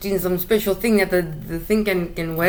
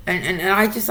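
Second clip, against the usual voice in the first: ordinary talk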